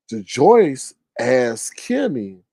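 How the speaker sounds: ordinary talk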